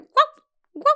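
high, loud woof woof; dog bark. Shown as a demonstration. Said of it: "It's not wow. It's like".

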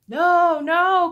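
high, loud cry of protest. A narrator's reading. Rulers refusing Pilate, crying out. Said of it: "cried the rulers".

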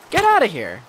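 loud, high get out; shout. Real, not acted. Yelling at a zombie to get out. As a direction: yell it at the zombie.

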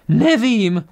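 loud, high, rattled outburst; nervous. A narrator's reading. Doctor pressed about the attackers' weapons, nervous. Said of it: "řekl nervózní doktor".

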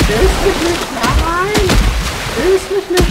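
panicked screaming. Real, not acted. Enemy grabbing, panicked.

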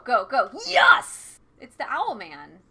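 usual speaking voice

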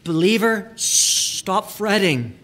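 a slow, lifted call to anxious believers; emphatic plea. Shown as a demonstration. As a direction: stretch stop fretting out slowly like a pleading pastor. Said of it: "I would translate it this way".

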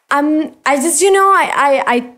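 ordinary delivery

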